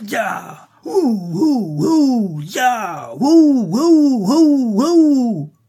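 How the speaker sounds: high, loud ululating whoops; war cry. A narrator's reading. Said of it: "Le cri de guerre des Indiens".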